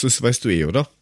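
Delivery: ordinary delivery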